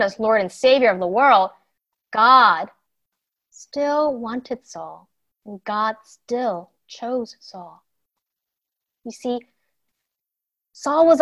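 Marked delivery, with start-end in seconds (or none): 3.5-7.8 slow and softened, leaning on still; slow tender emphasis. Acted. Teacher tells children God still chose Saul, slow and soft. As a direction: let still sink in slowly, gently. Stressing God's grace.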